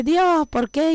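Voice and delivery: retold plea, lightly acted; imitated cry to God. Believer calls out to God asking why, mock cry. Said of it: "uno grita y dice".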